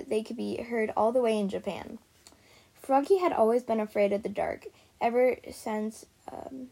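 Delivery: usual speaking voice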